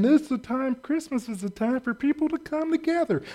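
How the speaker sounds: high, soft puppet-frog voice, a bit far from his own; Kermit the Frog. Retold or imitated. Mocking holiday unity speakers as a high, soft frog. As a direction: do a hammed-up Muppet frog. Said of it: "they talk like Kermit the Frog".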